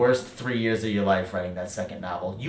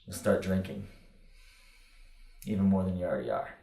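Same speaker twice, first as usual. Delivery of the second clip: normal talking voice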